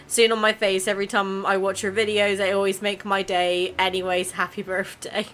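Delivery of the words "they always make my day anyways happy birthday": ordinary talk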